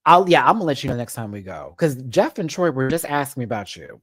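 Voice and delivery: normal talking voice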